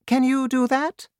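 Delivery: high, regal voice of the Queen; Queen's voice. Acted. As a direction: speak up high like a worried queen.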